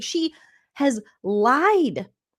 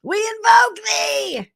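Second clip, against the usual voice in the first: much higher, slow shouted spell; shouted invocation; 11 semitones higher, 4 dB louder, slower than usual. Retold or imitated. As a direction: bellow the spell slowly like a witch. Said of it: "she was like this".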